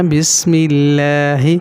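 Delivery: بسم اللہ drawn out, with a light "milla"; thin l. Shown as a demonstration. Teacher repeating the thin example, light l in بسم اللہ. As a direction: say اللہ in بسم اللہ with a clear, flat-tongued l. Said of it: "تو باریک ہو گیا نا".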